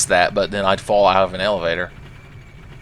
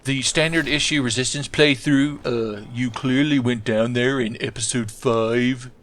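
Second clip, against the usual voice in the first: pedantic put-on viewer voice; 3 semitones higher than usual. Nerdy viewer correcting the player's forgotten canon, pedantic put-on voice. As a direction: play a fussy fan correcting the canon, slightly raised pitch. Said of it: "The stereotypical nerds".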